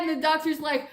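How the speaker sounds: ordinary talk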